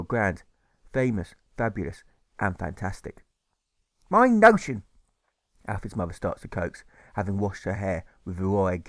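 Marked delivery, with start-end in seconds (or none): 4.1-4.8 mother's voice. Acted. Mother exclaims in story.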